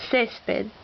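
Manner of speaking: césped with CÉS stressed; stress on accented syllable. Shown as a demonstration. Teacher listing words with written accents, stress on accented syllable. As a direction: lean on the first syllable of césped. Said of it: "words that need written accents".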